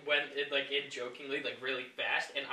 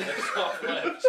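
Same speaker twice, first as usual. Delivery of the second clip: laughing; 8 semitones higher, 6 dB louder than usual. Real, not acted. Laughing while boasting about lips.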